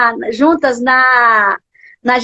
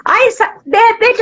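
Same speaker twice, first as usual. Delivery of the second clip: ordinary talk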